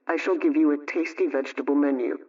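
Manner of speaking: ordinary talk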